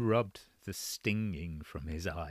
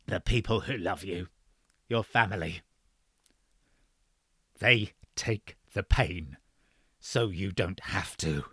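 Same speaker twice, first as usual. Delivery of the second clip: put-on gentle old man; 4 dB louder than usual. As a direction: pass on hard wisdom tenderly. McAllister's parting advice.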